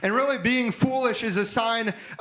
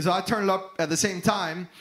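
ordinary delivery

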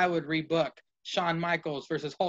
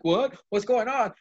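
higher, hammed-up fake surprise; mock shock; 6 semitones higher than usual. Michaels fakes shock at Hogan, hammed-up high surprise. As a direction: play it like a wrestler faking shock. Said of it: "Shawn Michaels pretending to be shocked".

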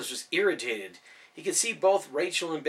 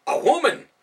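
startled exclamation; 13 semitones higher, 10 dB louder than usual. A narrator's reading. Ben startled by Rachel.

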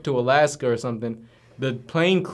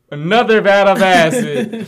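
lightly voiced Rick, higher and louder; loud, higher mock announcement of another vat; mock Rick line; 6 semitones higher, 8 dB louder than usual. Retold cartoon twist for his friend, louder higher Rick voice. Rick tricks the other Ricks.

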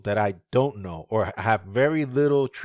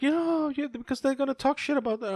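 wife's nagging. Retold or imitated. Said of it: "my wife is the one".

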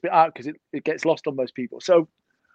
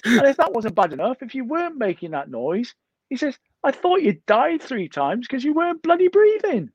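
exasperated mate; 10 semitones higher than usual. Retold or imitated. Friend's complaint retold higher.

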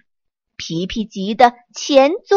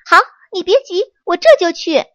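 high, loud and fast eager reply; loud promise; 8 semitones higher, 7 dB louder, much faster than usual. Acted. Monkey loudly promising the sick tree to go at once. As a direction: shout it out, ready to dash off. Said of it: "皮皮大声地说".

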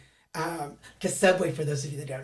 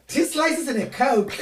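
proud confident order; 5 semitones higher, slower than usual. Retold or imitated. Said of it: "And we're like".

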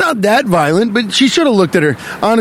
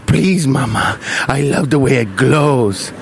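put-on low, slowing German-accented plea; 4 semitones lower, slower than usual. Ponce begging mama to allow fire, in a German accent. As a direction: plead in a comic German accent. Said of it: "I don't know why he's German".

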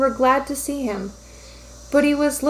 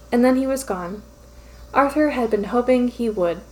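ordinary delivery

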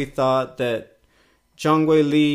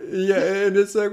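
normal talking voice

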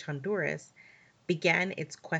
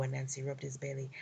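ordinary delivery, nothing marked

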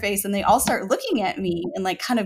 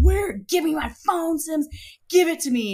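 urgent demand; 8 semitones higher than usual. Retold or imitated. Wife demands husband's phone, urgent demand. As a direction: demand it in a high, insistent voice.

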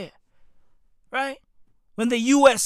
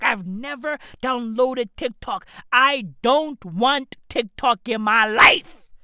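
usual speaking voice

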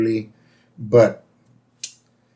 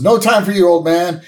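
lightly acted hurried brush-off, higher and louder; Homer; 6 semitones higher, 4 dB louder than usual. Homer brushing past Grandpa, mildly acted urgent voice. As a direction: raise the voice a little, mock urgency. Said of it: "and Homer goes".

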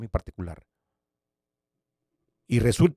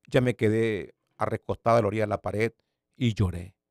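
ordinary delivery

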